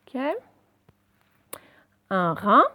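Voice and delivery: usual speaking voice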